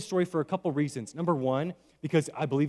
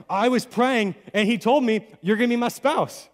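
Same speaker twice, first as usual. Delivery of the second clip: mock solemn prophecy; 6 semitones higher, 7 dB louder than usual. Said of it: "And I'm like".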